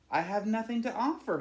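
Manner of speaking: a lonely bird underselling himself; lightly voiced, a touch of sadness; humble sigh. A narrator's reading. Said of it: "he thought".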